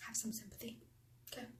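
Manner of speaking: hushed whisper to the viewers; whispered plea. Pretend. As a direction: whisper it as a little aside. Whispered aside, then said aloud.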